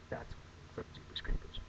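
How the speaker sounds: whispered aside. Acted. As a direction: breathe the title as a whisper.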